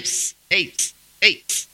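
hissed psst and short hey; psst-ing for someone's attention; imitated catcall. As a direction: furtively call after a passerby. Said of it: "Silbándole".

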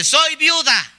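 high, emphatic declaration; widow announcing. Acted. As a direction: proclaim it for all to hear. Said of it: "¿Y ella qué dice?". (proclamando en voz aguda)

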